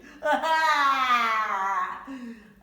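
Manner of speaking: loud high hammed-up boo-hoo; fake crying. Tyra upset on the shelf, hammed-up wailing sob. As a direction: wail like a sobbing child.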